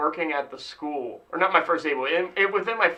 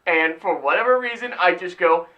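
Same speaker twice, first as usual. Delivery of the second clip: ordinary delivery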